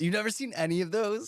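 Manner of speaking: surprised disbelief. Real, not acted. Shocked friend missed classic films.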